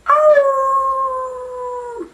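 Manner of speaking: high, yelping dog howl; a bad but earnest impression of a dog howling. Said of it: "impersonator of a dog".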